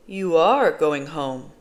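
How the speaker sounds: slow "are", drawn out and leaned on; stress on are. A narrator's reading. Smiling social worker corrects the girl, long firm stress on "are". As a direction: lean hard on "are" in a loud, slow reassurance. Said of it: "still smiling".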